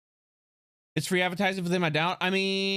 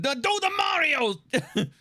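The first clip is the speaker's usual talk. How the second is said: cartoon Mario shout; 8 semitones higher, much slower than usual. Mimicking the Super Show theme.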